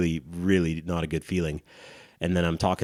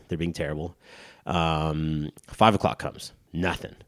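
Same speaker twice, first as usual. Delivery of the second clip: usual speaking voice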